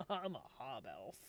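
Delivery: low, drawn-back sassy reply. Shown as a demonstration. Hob Elf introducing itself at the tavern table, low sass. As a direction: drop the voice and answer with sass. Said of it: "you say with sass".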